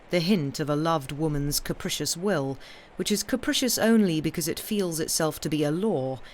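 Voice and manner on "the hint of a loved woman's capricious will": ordinary delivery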